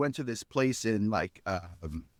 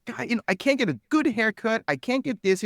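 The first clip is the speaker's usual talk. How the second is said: retold gripe, pitched high; exasperated; 10 semitones higher, 4 dB louder than usual. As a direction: complain in a higher, fed-up voice. Venting about base life in retold quote.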